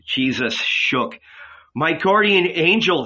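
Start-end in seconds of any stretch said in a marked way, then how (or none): none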